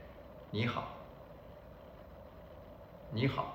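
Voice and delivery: 你好 said quickly and softly, syllables run together; natural greeting. Shown as a demonstration. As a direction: toss off 你好 as one light, short word. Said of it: "I would just teach you, just say".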